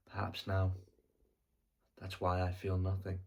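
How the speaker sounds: normal talking voice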